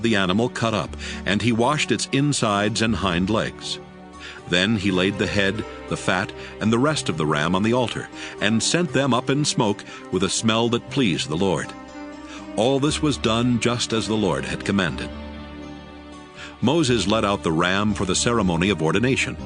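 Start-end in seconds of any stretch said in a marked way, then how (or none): none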